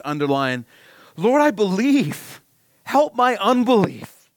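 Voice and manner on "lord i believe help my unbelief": high, loud, slow anguished plea; crying out with tears. Acted. Father begging Jesus to heal his son, anguished high cry. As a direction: cry it out, pleading and breaking. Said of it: "cried out and said with tears".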